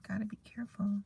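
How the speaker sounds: soft hushed caution; whispered warning. Real, not acted. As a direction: drop to a whisper to warn.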